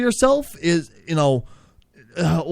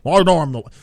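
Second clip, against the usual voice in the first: ordinary delivery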